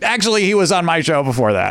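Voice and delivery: high, know-it-all correction; smug correction. Retold or imitated. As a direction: correct him with smug pride. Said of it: "Jesse Thorne will be like".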